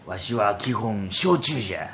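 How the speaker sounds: put-on slow, soft dialect old man; lightly voiced village elder; creaky wise old man. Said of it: "千鳥をっていうね".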